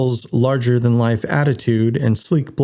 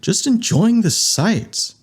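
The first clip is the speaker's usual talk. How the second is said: high, slow, airy excuse; playing innocent with a lilting voice; feigned innocence; 11 semitones higher, slower than usual. A narrator's reading. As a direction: draw it out innocently, pitched up. Winston feigns innocence to the officer.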